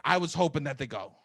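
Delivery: normal talking voice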